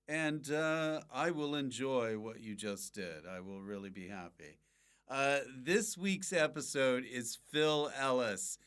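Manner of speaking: ordinary delivery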